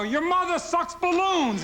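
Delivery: high, slow mock heckle; a tennis fan hurling a silly insult; heckler yell. Comic heckling a tennis crowd, slow high mock yell. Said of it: "I'm waiting for".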